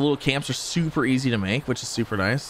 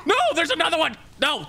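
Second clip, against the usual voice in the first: panicked shout; faster than usual. Real, not acted. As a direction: yell it in sudden alarm. New enemy appears.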